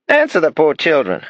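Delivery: put-on an old lady putting her foot down; bossy grandmother. Grandmother scolds the father.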